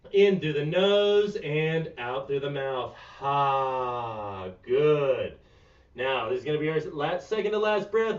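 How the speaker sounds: quiet, calm instructor guiding stretches; soft cool-down cueing. Acted. Yoga coach guiding the class through breathing, soft and calm.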